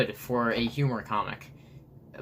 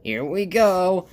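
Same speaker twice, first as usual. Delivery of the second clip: high, loud cartoon excitement; gleeful hype; 7 semitones higher, 5 dB louder than usual. Stickmon launches his buddy down Everest, high loud glee. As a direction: crow it, dragging out the launch words.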